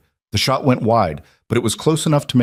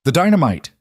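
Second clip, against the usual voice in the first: urgent shout; 7 semitones higher, 3 dB louder than usual. A narrator's reading. Urgent warning shout continues.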